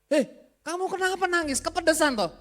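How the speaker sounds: high, loud, startled asking; surprised question. Retold or imitated. Preacher asks crying friend over noodles, surprised. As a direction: ask her why she's crying, surprised.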